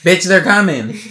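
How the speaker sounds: loud, hammed-up alarm; comic shouted warning. Redoing the creepy party warning as a joke, loud mock shout. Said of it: "Someone should redo it when King".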